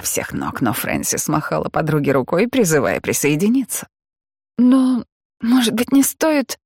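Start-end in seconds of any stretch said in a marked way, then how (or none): 4.6-6.6 put-on high, timid, unsure girl's voice; hesitant mumble. A narrator's reading. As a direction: mumble it, afraid to object. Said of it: "промямлила Джорджиана".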